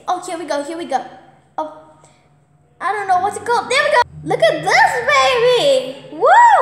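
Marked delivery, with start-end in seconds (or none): 3.7-6.6 excited cheer. Real, not acted. Catches another fish, cheering.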